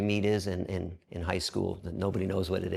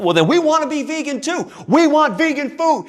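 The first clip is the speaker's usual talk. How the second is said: loud demand; 12 dB louder than usual. Retold or imitated. Inmates demanding vegan food, loud demand. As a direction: insist loudly.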